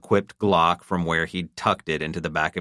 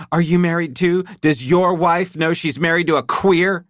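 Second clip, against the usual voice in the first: high, louder biting taunt; accusing wife; 12 semitones higher, 6 dB louder than usual. A narrator's reading. Wife taunting the stranger, biting and loud. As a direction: needle him hard.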